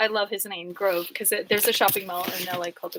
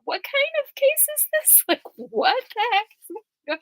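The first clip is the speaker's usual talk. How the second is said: much higher, squeaky mock-baffled judge; 12 semitones higher, slower than usual. The judge staring at the prosecutor, squeaky disbelief. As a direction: squeak it out in disbelief, slowing at the end. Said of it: "looking at them like".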